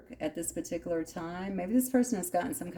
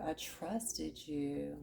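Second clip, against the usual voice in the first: softer and slow, voice catching; emotional, hushed; 9 dB quieter, much slower than usual. Real, not acted. Reader voicing the hurt partner's words to Sag, soft and slow. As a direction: let the hurt slow you down to almost nothing. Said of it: "Damn, I'm getting emotional".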